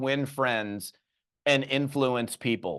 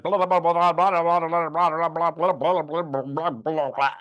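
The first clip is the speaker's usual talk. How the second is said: babbling blah; 3 semitones higher than usual. Retold or imitated. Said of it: "and you're like".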